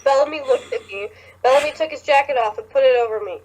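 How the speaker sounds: usual speaking voice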